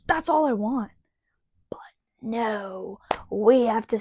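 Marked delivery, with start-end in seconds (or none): 1.7-4.0 whispered no. Real, not acted. Mock resigned aside, unvoiced.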